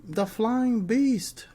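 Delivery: drawn-out, high mock ring announcer call; lightly hammed-up commentator nickname call. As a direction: stretch it out like a wrestling announcer. Dubs wrestler like an announcer.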